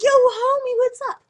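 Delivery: high, loud homie call; mock swaggering greeting. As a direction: imitate a wannabe tough kid greeting a pal.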